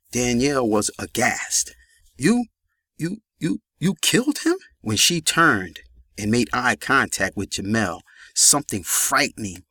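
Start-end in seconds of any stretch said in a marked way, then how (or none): 2.2-4.6 shocked stammer. A narrator's reading.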